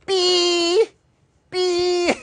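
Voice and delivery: very high, piercing mock bleep; shrill beep noise over a swear; censor beep. Teacher beeps out a censored word.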